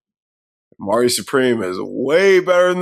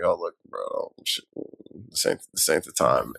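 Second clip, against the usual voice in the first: low hushed brush-off; 8 semitones lower, 9 dB quieter than usual. Retold or imitated. Retelling a bouncer turning him away.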